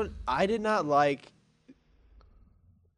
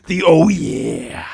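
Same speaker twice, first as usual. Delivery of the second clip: slow, loud wrestler growl; Macho Man voice; 2 semitones higher, 12 dB louder, much slower than usual. Retold or imitated. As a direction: drag it out like a wrestler. Macho Man impression of name.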